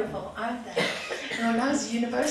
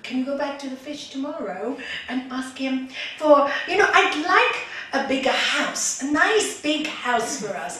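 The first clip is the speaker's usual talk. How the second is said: loud request climbing higher; storyteller's wife, loudly wishing for more; eager, coaxing wife; 4 semitones higher, 9 dB louder than usual. Acted. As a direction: coax him with mounting excitement. Wife asks for a bigger house.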